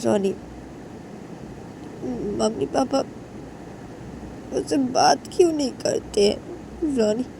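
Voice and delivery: high, tearful sobbing plea to a friend; crying. Acted. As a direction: cry it out, voice breaking high and pleading. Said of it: "रोते रोते बोलता है".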